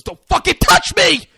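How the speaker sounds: high furious scream. Retold or imitated. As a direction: yell it right into her face. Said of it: "I just screamed into it".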